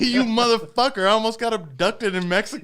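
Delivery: loud, high yell through laughter; laughing yell. Retold or imitated. To his friend in the rental car, a loud laughing yell. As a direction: blast it at your buddy, half laughing.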